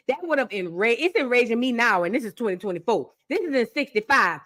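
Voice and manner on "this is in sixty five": normal talking voice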